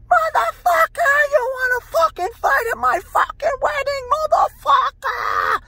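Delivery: very high, drawn-out falsetto shriek; hammed-up piercing, furious screech; wife screaming. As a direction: imitate an enraged bride screaming. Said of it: "I hear screaming".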